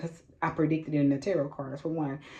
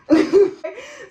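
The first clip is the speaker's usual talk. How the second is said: loud, high burst of laughter; a shrieking laugh that breaks out; big high laugh; 11 dB louder than usual. Real, not acted. Laughing out loud after telling listeners she didn't want to. Laughs at her own story.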